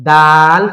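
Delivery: দাল loud, with a long held vowel; drawn-out letter name. Shown as a demonstration. Teacher showing that the name is elongated, drawn out. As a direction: stretch the vowel in দাল loudly. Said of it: "কেন দাল টান দিলাম".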